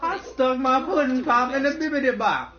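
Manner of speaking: high Cosby scat; Bill Cosby impression. Bill Cosby confessing, up high.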